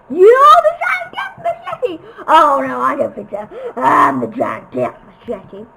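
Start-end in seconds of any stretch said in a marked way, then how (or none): 0.1-2.0 high, loud mock shriek of fright; mock scream. Mock fearful cry. 3.8-5.7 much lower, louder villain boast; mock villain. Villain answer, low.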